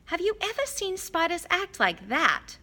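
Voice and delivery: put-on high, curious girl's voice with a wondering lilt; wondering Hermione. A narrator's reading. Girl pointing out spiders to her friends, wondering. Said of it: "said Hermione wonderingly".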